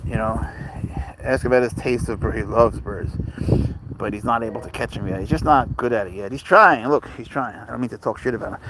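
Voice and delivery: ordinary delivery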